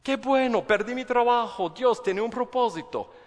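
high, loud, sarcastic cheer; sing-song mock positivity; mock cheerful. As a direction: fake upbeat optimism about bad news. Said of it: "Yo digo".

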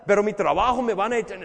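worried objector rushing. Acted. Preacher voicing a congregant afraid of losing his job, fast and loud. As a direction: blurt out the fear of being fired.

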